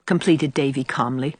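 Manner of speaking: ordinary delivery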